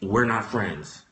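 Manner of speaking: low, soft, flat-voiced line; quiet, deadpan mock of Mister Willie; deadpan retort. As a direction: drop the voice and shut him down. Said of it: "I said".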